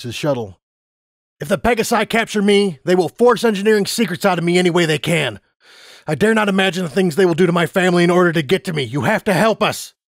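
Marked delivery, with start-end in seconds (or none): 1.4-9.9 higher, louder, rushing plea; desperate pleading father. Acted. Father begging the Griffin to take his family, desperate. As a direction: beg as if the family's life depends on it.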